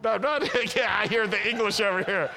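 laughing at the congregation's English. Real, not acted.

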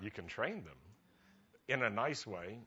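usual speaking voice

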